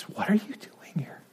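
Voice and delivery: hushed whispered question; mocking whisper. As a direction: whisper it, quoting the quiet voice back. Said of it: "all you can whisper to me".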